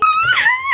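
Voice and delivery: loud, very high squeal; half-laughing, half-crying shriek; overcome squeal. Real, not acted. Reader reacting to him rising from the floor, overcome squeal.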